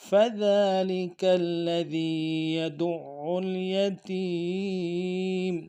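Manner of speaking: slow melodic tajweed chant; Quran recitation. Shown as a demonstration. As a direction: recite the verse with care. Full recitation of verse.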